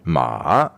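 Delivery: "ma" dipping low then rising back up; Mandarin third tone. Shown as a demonstration. Teacher showing the interviewer the third tone, dipping. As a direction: dip "ma" down and up. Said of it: "The third tone is first falling and then going up again".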